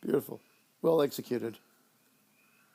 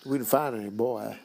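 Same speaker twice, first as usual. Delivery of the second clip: imitating the sheriff; 7 semitones lower, 3 dB quieter than usual. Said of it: "He doesn't say it like that at all".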